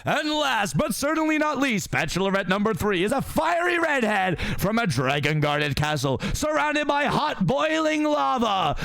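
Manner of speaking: high, slow showman announcing the prizes; game-show host voice. Acted. Magic mirror presenting bachelorettes to Farquaad, high slow host voice. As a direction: drawl it out like a dating-show host.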